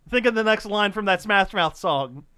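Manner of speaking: ordinary talk, nothing marked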